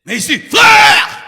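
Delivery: slow, huge preacher's shout; pulpit shout. Shown as a demonstration. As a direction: bellow it to the crowd. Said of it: "Ici, je peux haranguer".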